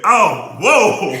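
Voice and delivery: high, loud gasping whoa; pained exclamation. Real, not acted. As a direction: react to the burn, slow. Reacting to burning spice.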